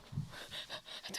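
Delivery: sharp unvoiced intake of breath; mock gasp. Musical director panicking with the writer watching, mock gasp. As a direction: gasp as if caught out. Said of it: "but also you go".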